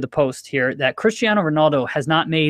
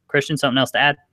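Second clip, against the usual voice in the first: normal talking voice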